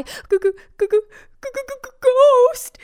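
terrified stammer. A narrator's reading. Old woman sees the ghost, terrified high stammer. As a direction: stammer shrilly, then stretch the last word.

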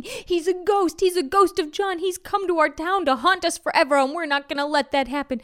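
high, excited accusations tumbling out; mob shouting. A narrator's reading. Townspeople accusing the ghost to the teacher, high-pitched shouting. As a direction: cry out in alarm, speeding up at the end. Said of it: "Everyone started shouting and pointing".

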